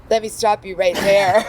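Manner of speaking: high, loud cut-in with a laugh; mock interruption. Speaker halting the patronizing admirers, loud mock interruption. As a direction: cut them off loudly, amused.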